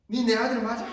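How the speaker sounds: 높고 큰 소리로 쏘아붙이기; 아들을 탓하듯 짜증스럽게; 짜증 난 아버지. Retold or imitated. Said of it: "짜증을 내는 거예요". (짜증 내며 따져 묻는 말투)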